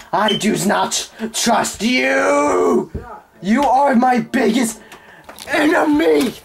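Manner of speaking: high, slow, hammy outrage; melodramatic accusation. Pretend. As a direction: declare it like a movie hero. Dramatic declaration at the kid.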